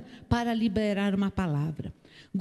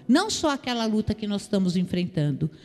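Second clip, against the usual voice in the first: usual speaking voice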